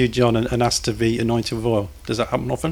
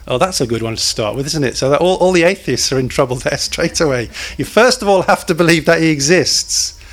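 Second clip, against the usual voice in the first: normal talking voice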